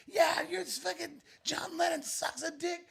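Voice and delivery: high-pitched mock holler; retold yell. Still arguing about John Lennon, echoing down an alleyway, mock yelling. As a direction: imitate a far-carrying yell without the volume. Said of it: "we're still yelling at each other".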